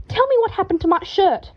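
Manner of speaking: high, loud demanding voice; angry. A narrator's reading. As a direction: demand it furiously. Draco demanding answers.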